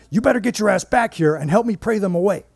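high, loud panicked screech. Retold or imitated. Nancy screeching at her coworker over the phone, high and loud. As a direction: shriek it down the phone. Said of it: "she screeched into the phone".

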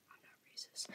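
tiny unvoiced whisper. Real, not acted. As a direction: whisper it as a joke. Whispered joke aside.

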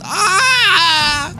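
startled cry. Real, not acted. Startled mid-story in game.

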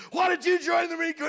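high, loud drill sergeant bark; drill sergeant yelling. Retold or imitated. Drill sergeant yelling at a recruit, high loud bark. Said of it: "drill sergeant is screaming".

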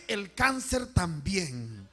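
drawn-out, falling repeat; measured, stretched echo; slow solemn repeat. Real, not acted. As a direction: say it slowly, letting the tune drop.

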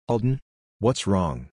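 plain reading voice

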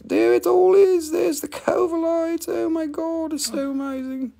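high, thrilled gasp of wonder; excited reveal. Real, not acted. Seller reveals the stone to viewers, high thrilled wonder. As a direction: show it off like a treasure.

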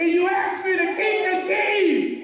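high, slow preacher's shout imitating a tearful plea; impassioned retold plea. Preacher voicing bawling Sue's prayer, impassioned shouted plea. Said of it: "And she was bawling".

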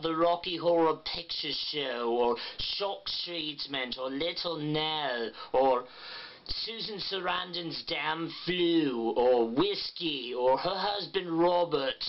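slow, exaggerated vowels with a tight jaw; Pat Quinn impression. Shown as a demonstration. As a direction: drawl her topics like Pat Quinn. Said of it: "practicing with topics that she generally talks".

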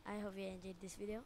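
ordinary talk, nothing marked

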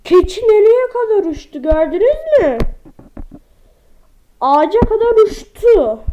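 loud, high amazed outburst; amazed exclaim. Real, not acted. Telling viewers the goat flew, loud amazed. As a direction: marvel at it out loud.

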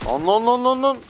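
high, loud repeated lan; panicked yelling. Real, not acted. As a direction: yell in panic. Panicked shouting in a fight. (panikle bağırarak)

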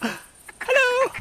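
very high falsetto call; squeaky animal greeting. Pretend. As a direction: try to talk to a duck in squeaks. Trying to communicate with the duck.

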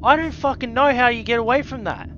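exasperated. Real, not acted. Frustrated rhetorical outburst.

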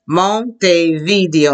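"Montevideo" slowly, broken into syllables; slow modelling. Shown as a demonstration. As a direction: model mon-te-vee-DEE-o for "Montevideo" piece by piece. Models a hard name slowly.

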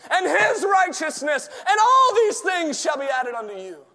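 high, loud shouted scripture; full-force preaching. Acted. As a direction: preach it with everything you've got.

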